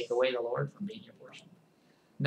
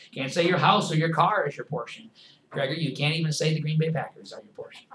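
usual speaking voice

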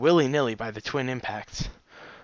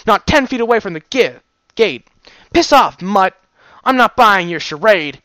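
loud, higher sneering rant; sneering guard; 6 semitones higher, 8 dB louder than usual. Acted. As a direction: spit the insults with scorn. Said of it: "she sneered".

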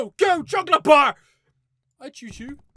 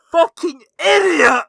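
loud angry yell at the game; yelled curse; 11 dB louder than usual. Real, not acted. Player screaming at the teacher, furious yell. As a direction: bellow the insult out loud.

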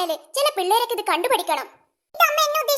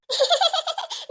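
high teasing giggle; cheeky giggle; 4 semitones higher than usual. Acted. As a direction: giggle at her sister's trouble. Girl laughs at scolded sister. (കളിയാക്കി ചിരിക്കുന്നു)

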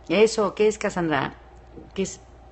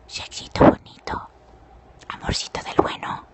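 teasing sultry whisper; playful whisper. Pretend. Susurra insinuante, en broma. (susurro pícaro)

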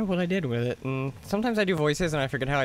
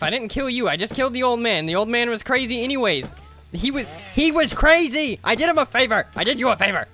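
defensive; 6 semitones higher, 6 dB louder than usual. Real, not acted. Defending himself to NPC.

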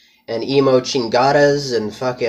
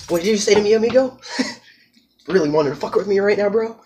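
high, fast, laughing tough-guy taunt; mock gangster challenge; 8 semitones higher, faster than usual. Narrator taunts the guy who messed with him, laughing. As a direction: taunt him like a cocky thug. Acted challenge before gunfight.

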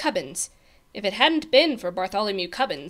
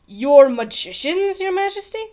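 shivering dread; 9 semitones higher, 8 dB louder than usual. A narrator's reading. Page boy dreading the king's magicians, alarmed. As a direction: ask it in alarm, rising high. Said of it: "Bartholomew shivered".

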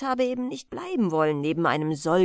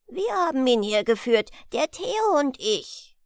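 put-on higher-pitched small boy insisting; guileless, anxious; 4 semitones higher, 3 dB louder than usual. A narrator's reading. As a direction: speak up earnestly as a worried child. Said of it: "treuherzig und ängstlich zugleich das kleinste Büblein". (treuherzig beteuerndes Büblein)

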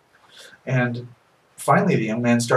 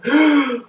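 gasp for air; 6 dB louder than usual. Acted. Boy comes up out of water.

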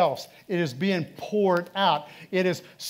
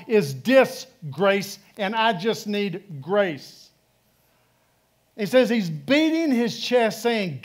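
loud, raised preaching voice; 4 semitones higher, 6 dB louder than usual. Acted. Pastor preaching to the congregation, loud and fervent. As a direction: build the sermon up, loud and high.

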